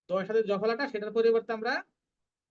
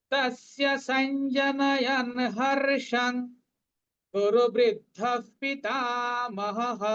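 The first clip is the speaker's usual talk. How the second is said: slow, higher chanting of the verse; verse recitation; 3 semitones higher, 3 dB louder, much slower than usual. Shown as a demonstration. Teacher chanting verse twelve for students. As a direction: chant it out slowly.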